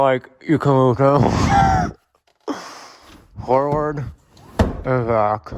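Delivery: talking around a phone in the mouth; muffled, phone clenched in teeth; phone held in teeth. Real, not acted. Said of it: "I just put my phone in my mouth".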